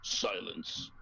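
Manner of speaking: low, gruff command; deep, curt order. Acted. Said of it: "the gruff voice answers".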